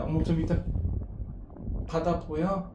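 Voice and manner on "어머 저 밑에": usual speaking voice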